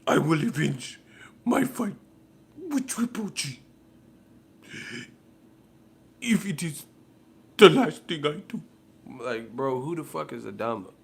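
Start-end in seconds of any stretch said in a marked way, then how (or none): 0.1-8.6 much higher, put-on epic oath voice; mock vengeful warrior. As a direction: swear revenge like a cheesy movie hero. Adama vowing revenge, parody.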